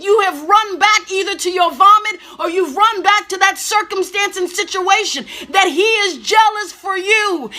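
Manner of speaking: rising, fervent preaching voice. Real, not acted. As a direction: preach it with building fervour. Preaching at full force.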